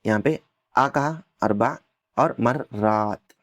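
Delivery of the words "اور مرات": slow مرات with the alif drawn long; marraat, alif held twice as long; stretched alif. Shown as a demonstration. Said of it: "یہاں پہ اس کو الف کو کھینچا".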